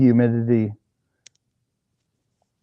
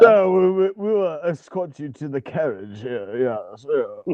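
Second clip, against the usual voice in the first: mock posh butler; slower than usual. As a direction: play a stuffy English butler, lightly. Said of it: "like a fucking Butler accent".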